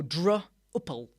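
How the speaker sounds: slow, stretched "Drupal" as "droh-pal"; drawn out "Drupal" with an oh vowel; trying out "Drupal" slowly as droh-pal; alternate Drupal. Shown as a demonstration. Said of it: "Or would it be Drupal?".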